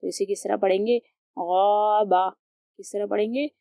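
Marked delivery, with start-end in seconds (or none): 1.4-2.3 गौबा with a full, heavy "ghau"; heavy ghain. Shown as a demonstration. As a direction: fill the mouth on गौबा. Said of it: "हुर्फ मुस्तालिया मोटा पढ़ेंगे और इसे पढ़ते वक्त मुंह".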